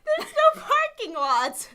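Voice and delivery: high, loud, drawn-out laughing protest; laughing disbelief. Real, not acted. Host corrects co-host's parking lot slip, high and laughing. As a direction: squeal it incredulously through laughter. Teasing co-host's wrong word.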